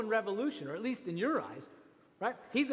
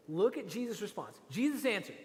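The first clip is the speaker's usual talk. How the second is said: normal talking voice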